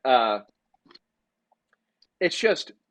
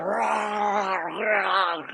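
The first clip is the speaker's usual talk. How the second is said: a growled rah; mock dog growl; 4 semitones higher than usual. Dog chasing the screaming kid, a growled rah. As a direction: bark it like a lunging dog. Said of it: "the dog would just".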